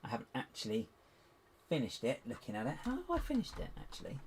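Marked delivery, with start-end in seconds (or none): 2.8-4.2 high mock question. Real, not acted. Self-mocking high question.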